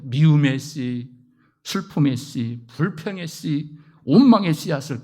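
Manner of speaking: slow, weighty list of seeds. Real, not acted. Preacher listing Satan's seeds, slow and weighty. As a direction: lay each one down slowly. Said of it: "slow listing".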